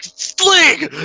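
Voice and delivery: high-pitched hammed-up yelp; mock outraged yell. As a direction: squeal it like a stickler losing his mind. Walter's outburst imitated.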